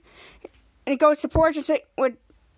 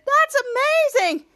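excited gush; 11 semitones higher, 4 dB louder than usual. Retold or imitated. Chris excited.

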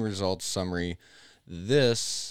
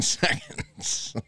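laughing; 3 semitones higher, slower than usual. Real, not acted. Absurd trillion-second bug.

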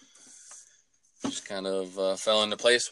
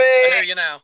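shouting into a phone; 11 semitones higher, 14 dB louder than usual. Real, not acted. Line comes back, calls out.

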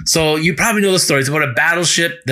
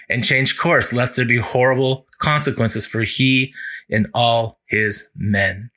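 ordinary delivery

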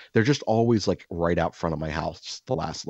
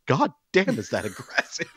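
high laugh through the words; laughing disbelief; 13 semitones higher than usual. Retold or imitated. Reacting to his wife's blunt order, laughing in disbelief. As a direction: crack up at how harsh she is.

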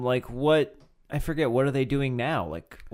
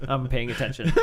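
ordinary delivery

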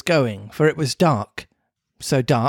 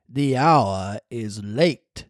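low, slow ghostly cricket; slow low voice of a spirit; talking cricket voice; 4 semitones lower, much slower than usual. Acted. The cricket warns.

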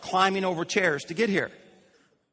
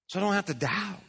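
normal talking voice

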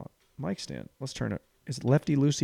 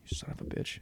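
whispered curse; 17 dB quieter, faster than usual. Acted. Muttered aside.

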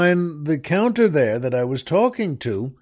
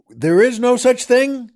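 pitched up and louder in disbelief; incredulous echo; 8 semitones higher, 4 dB louder than usual. Acted. Echoing the waiter's claim to the audience, in disbelief. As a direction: throw the claim back, amazed.

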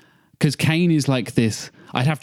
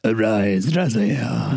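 grave slow line with a creaky fry at the end; vocal fry endings; much slower than usual. Retold or imitated. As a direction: let the last word sink into vocal fry. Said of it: "that vocal fry at the end there".

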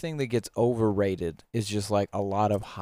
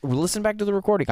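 insistent; 10 semitones higher, 3 dB louder, faster than usual. Real, not acted. Insisting during playful argument.

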